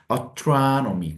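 astronomy stretched, first vowel as 어 not 에; slow vowel demo. Shown as a demonstration. Teacher correcting the vowel in astronomy. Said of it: "에로 발음되지 않고 어로".

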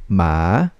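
"ma" dipping low, then rising; third tone. Shown as a demonstration. Teacher reviews the four tones, "ma" in falling-rising third tone. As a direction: sink down on "ma" and climb back up. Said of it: "Third tone".

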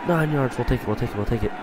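usual speaking voice

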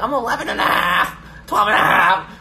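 high, loud mock-kid shout; hammed-up childish holler; child proud of halves. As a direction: boast it like a little kid, stretching it. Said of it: "I remember when halves were so important".

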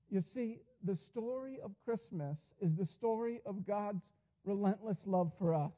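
normal talking voice

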